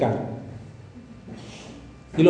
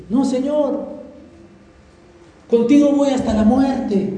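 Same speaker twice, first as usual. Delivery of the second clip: Peter's protest, pitched up and insistent; boastful Peter; 7 semitones higher than usual. Retold or imitated. Preacher voicing Peter insisting he will never deny Jesus, raised and earnest. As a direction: sure of himself, swearing loyalty to the death.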